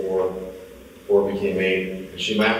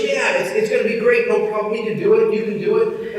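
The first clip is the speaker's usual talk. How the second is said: breezy cheerleading wife; 12 semitones higher, 4 dB louder than usual. Retold or imitated. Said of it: "She goes".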